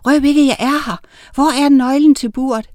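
small, high secretive voice; high, hushed-style little voice; whispering little witch. A narrator's reading. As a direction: speak as a tiny witch keeping hidden. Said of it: "hviskede hun".

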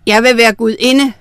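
loud, imperious demand. A narrator's reading. Wife as queen wanting to be goddess, demanding. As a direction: demand it loudly, brooking no refusal. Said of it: "forlangte hun".

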